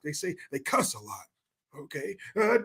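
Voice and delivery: usual speaking voice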